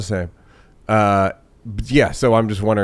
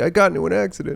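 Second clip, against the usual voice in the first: high-pitched comic plea; mock pleading; 7 semitones higher, much faster than usual. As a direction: plead pathetically high, playing it for laughs. Acts himself begging after an accident.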